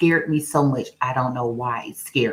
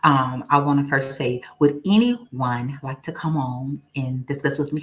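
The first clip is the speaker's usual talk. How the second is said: ordinary delivery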